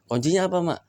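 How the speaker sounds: put-on a child begging to know the secret; eager young son. Son eagerly asks for the key. (anak bertanya dengan suara tinggi)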